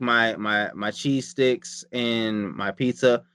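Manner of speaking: ordinary delivery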